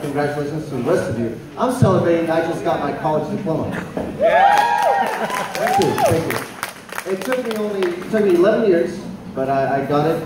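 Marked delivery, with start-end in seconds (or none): 4.2-6.4 squeaky falsetto cheer, thanking himself; high-pitched mock self-congratulation. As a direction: cheer for yourself in a tiny high voice. Mock self-applause after diploma.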